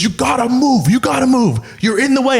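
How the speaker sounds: high, pushy bouncer mimicry. Imitating the bouncer shoving him at the bar, high and pushy. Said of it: "and he goes, he goes".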